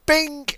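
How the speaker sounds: a sharp, high chime effect; ping it out like a toy bell; high "bing" sound effect. Pretend. Playing truck game, voicing the container landing with a high bing.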